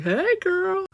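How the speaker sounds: put-on high and loud girly greeting; chummy greeting. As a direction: greet her like a best friend.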